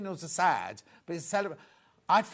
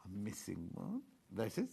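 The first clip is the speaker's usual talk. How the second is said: soft whisper while counting; whispered aside; 9 semitones lower, 14 dB quieter than usual. Real, not acted. Counting stock and finding one missing, whispered. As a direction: breathe it to yourself, no voice.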